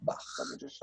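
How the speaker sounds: whispered aside while checking; muttered to self. Real, not acted. Teacher checks the file and whispers to themself while searching. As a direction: mutter it under your breath as you look. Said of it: "Hold on".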